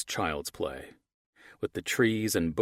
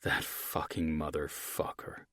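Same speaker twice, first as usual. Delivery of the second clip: seething; 2 semitones lower, 7 dB quieter, slower than usual. A narrator's reading. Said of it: "anger raged inside of me".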